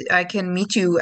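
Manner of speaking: usual speaking voice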